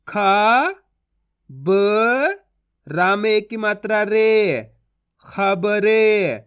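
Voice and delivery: drawn-out syllables, loud; slow spelling-out demo. Shown as a demonstration. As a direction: stretch खबरे very slowly. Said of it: "अब चलिए आगे देखिए".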